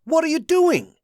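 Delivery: high, loud demand; alarmed shout. A narrator's reading. Josh shouting at Penelope and Abraham. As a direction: call out in alarm.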